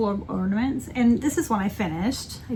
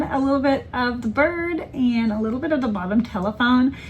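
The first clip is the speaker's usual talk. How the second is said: ordinary delivery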